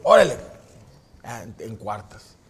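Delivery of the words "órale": loud órale. Retold or imitated. Dad agreeing.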